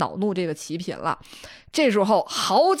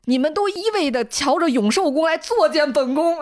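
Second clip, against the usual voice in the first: angry consort; 8 semitones higher than usual. Acted. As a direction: scold the maids in a raised, higher voice. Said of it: "非常生气的责打宫女". (齐嫔对宫女发火，声音拔高)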